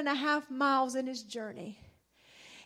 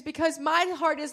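ordinary talk, nothing marked

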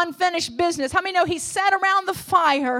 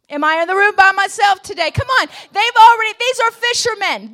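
normal talking voice

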